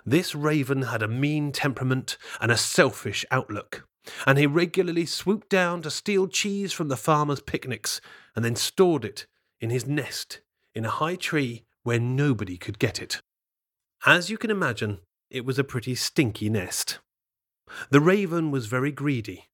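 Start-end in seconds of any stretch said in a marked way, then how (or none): none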